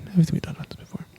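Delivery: quick, soft whisper; hushed, fast afterthought under the breath; whispered aside. Real, not acted. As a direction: drop to a hurried whisper. Hushed aside repeating the remark.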